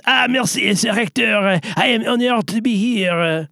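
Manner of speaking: put-on high, bright French accent; a hammy Frenchman greeting the host. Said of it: "Pierre the Shrimp is also joining us".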